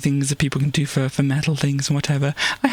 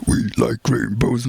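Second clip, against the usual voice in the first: low, slow growled line; Cookie Monster growl; 5 semitones lower, slower than usual. Retold or imitated. Recalling his doom metal clip, low growl. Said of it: "the Cookie Monster vocals".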